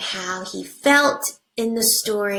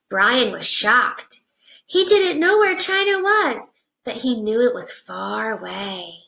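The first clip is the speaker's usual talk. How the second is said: put-on reading to children. Teacher reading a picture book to her class, animated storybook voice.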